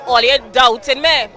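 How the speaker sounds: ordinary delivery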